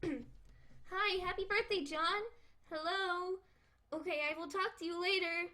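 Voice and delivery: a hint of a cheerful young girl; chirpy friendly greeting, lightly voiced; Jade voice. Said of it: "I need to do a perfect Jade voice".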